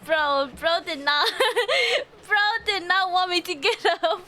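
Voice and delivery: words breaking into laughter; laughing disbelief. Real, not acted. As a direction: crack up while telling it. Laughing over being beaten.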